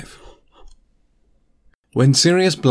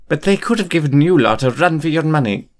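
higher, dry teacher voice; wry McGonagall; 6 semitones higher than usual. A narrator's reading. McGonagall to Remus, dry higher teacher voice. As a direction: concede with a dry edge.

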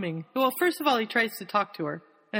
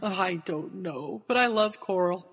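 usual speaking voice

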